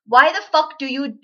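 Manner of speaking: ordinary talk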